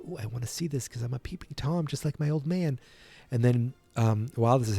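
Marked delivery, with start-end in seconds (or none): 0.1-2.8 softer, hushed peeping aside; mild mock whisper of a snoop; sneaky hush. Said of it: "Marty is peeking in like".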